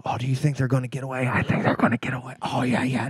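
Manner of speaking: hushed, hurried murmur; soft, fast chatter between imitated moviegoers; imitated audience whispering. Imitating audience discussing the getaway, hushed and rapid. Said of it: "you'd hear whispering".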